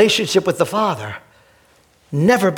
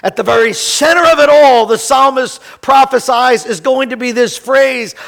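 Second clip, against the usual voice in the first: fast, louder preaching; 5 dB louder than usual. Acted. Pastor to congregation, building preaching. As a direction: drive the point home to the church.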